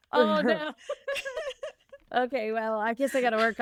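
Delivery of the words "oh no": laughing dismay. Real, not acted. Host told her Irish sounds Jamaican, laughing dismay. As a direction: groan through laughter. Teased about failed accent, laughing.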